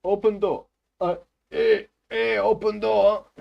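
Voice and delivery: put-on loud, high Russian-accented demand; mock a pushy Russian at the buzzer; insistent Russian-accented calling; imitated Russian neighbour. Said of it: "all I get is".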